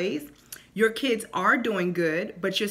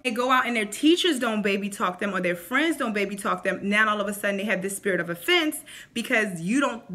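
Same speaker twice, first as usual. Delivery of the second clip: ordinary talk, nothing marked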